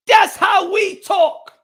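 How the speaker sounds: slow, high, loud declaration; emphatic shout. Real, not acted. Telling his chat how they talk, slow proud shout. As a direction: proclaim it to the whole chat.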